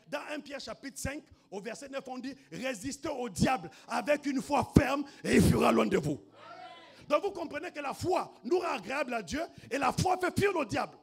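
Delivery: full-force preaching, loud and high. Acted. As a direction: drive the lesson home to the congregation. Prêche à pleine voix. (prédication enflammée, à pleine voix)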